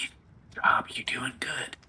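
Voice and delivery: soft, unvoiced stage whisper; whispered encouragement. Jeek quietly encouraging the player beside the fish, whispering. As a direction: whisper it as secret praise. Jeek's tiny thumbs-up encouragement.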